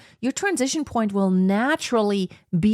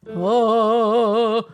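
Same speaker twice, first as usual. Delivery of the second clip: heavy dug-in note; 4 dB louder than usual. Shown as a demonstration. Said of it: "it sounds just like".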